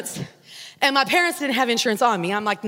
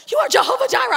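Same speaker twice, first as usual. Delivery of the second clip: mock grand prayer; 11 semitones higher, 3 dB louder, much faster than usual. Dramatic prayer to God.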